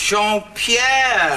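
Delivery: high, drawn-out joyful cry; delighted greeting. Acted. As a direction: hail a long-lost friend. Old friends reunited.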